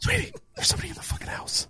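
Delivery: high, hushed panicked whisper of the wife; wife whispering urgently about a noise in the house. Retold or imitated. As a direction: whisper like a spooked wife at night. Wife waking him, voiced high.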